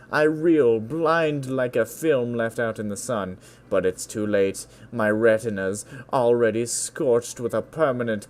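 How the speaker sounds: emphatic, theatrical spoken-word delivery; dramatic poem recital. Acted. Reading friend's free-form poem, dramatic recital. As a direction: perform the poem with punched bursts, settling down at the end.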